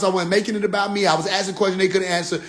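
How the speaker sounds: normal talking voice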